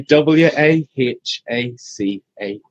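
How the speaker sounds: W A H A C A slow, each letter spaced out; slow careful spelling. Shown as a demonstration. Chef correcting the spelling for the host, slowly. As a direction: spell it out one letter at a time. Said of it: "I'm getting confused".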